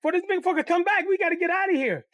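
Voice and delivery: put-on young boy asking his friend why he stopped; worried kid. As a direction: ask like a scared little kid. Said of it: "I said".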